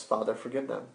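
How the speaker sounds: soft, low retold prayer; hushed plea. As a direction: quietly plead for mercy. Retelling Jesus' plea for mercy.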